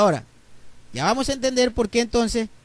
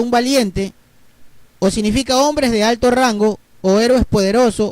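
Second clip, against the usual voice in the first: usual speaking voice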